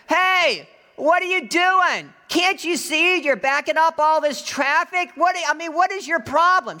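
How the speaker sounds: high, loud indignant yell. Retold or imitated. Woman yelling at the man for blocking traffic, indignant yell. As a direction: imitate an angry woman yelling across a store entrance. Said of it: "she starts yelling at me".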